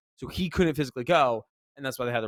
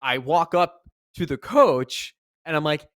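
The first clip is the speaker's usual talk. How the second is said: ordinary delivery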